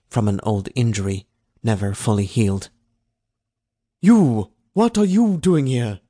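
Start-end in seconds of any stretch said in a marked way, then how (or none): 4.0-6.0 loud, high challenge to an intruder; overseer's demand. A narrator's reading. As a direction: call out sharply like a guard. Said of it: "a voice said to him in Hroom".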